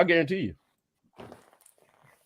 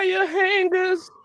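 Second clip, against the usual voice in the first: mock shriek; much slower than usual. Mocking Mommie Dearest line.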